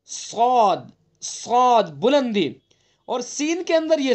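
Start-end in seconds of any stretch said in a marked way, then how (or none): 0.1-2.5 صاد said with a full heavy ص and the tongue raised; heavy emphatic صاد. Shown as a demonstration. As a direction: make صاد thick and heavy, slowly. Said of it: "صفت بلندی". (صاد کو استعلا کے ساتھ موٹا پڑھیں)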